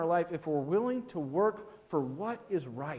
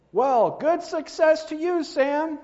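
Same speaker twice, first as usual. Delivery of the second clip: much higher and louder call; yelled back; 12 semitones higher, 10 dB louder, slower than usual. Acted. The other man yells after Sam, high and loud. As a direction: shout good wishes after him. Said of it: "The other man yelled back".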